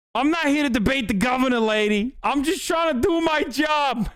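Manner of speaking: put-on a second try at the Midwestern cop accent, ending in a shout; hammed-up Midwestern cop accent, snapping "I'm just trying to do my job"; Midwestern cop impression. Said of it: "this guy's the peak fucking Midwestern cop".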